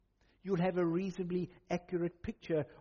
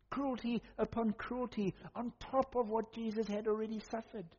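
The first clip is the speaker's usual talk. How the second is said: ordinary delivery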